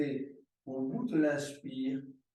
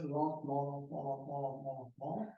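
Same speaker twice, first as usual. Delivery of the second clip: fading repeated cue; 6 dB quieter, faster than usual. Shown as a demonstration. Yoga teacher slowing the movement.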